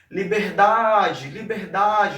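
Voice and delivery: "liberdade" called out with a long stretched DA; liber-DAAA-de, the stressed syllable held; calling out the word. Shown as a demonstration. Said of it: "como se estivesse chamando a palavra".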